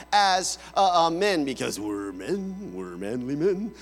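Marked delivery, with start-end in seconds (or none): 1.4-3.7 mock macho man. Preacher joking about men's hard exterior shell, mock macho. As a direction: put on a deep tough-guy voice.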